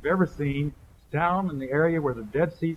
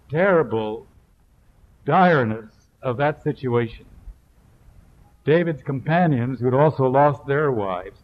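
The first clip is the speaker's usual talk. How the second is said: louder, building sermon delivery; fervent preaching; 6 dB louder than usual. Acted. Preacher warning the congregation, voice loud and driving. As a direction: preach it out with weight.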